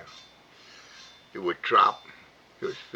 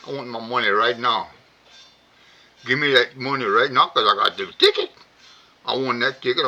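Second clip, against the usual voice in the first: raised, insistent customer demanding payout; angry demand; 3 semitones higher, 7 dB louder than usual. Retold or imitated. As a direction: push hard, get louder. Customer demanding lottery winnings.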